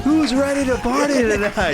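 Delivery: rowdy party animal. Acted. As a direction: shout to pump up the crowd. Party warm-up shout.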